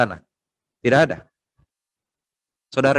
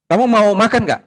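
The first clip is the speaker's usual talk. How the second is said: high, louder playful question; imitated question to child; 8 semitones higher, 5 dB louder than usual. Adult asks a child about eating, high imitated voice. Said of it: "saya dengar seorang anak kecil itu".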